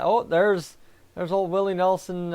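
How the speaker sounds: ordinary talk, nothing marked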